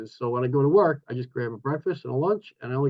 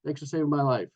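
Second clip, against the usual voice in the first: ordinary talk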